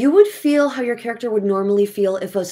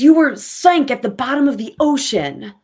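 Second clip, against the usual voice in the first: scream; 7 semitones higher, 4 dB louder than usual. Acted. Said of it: "She screams".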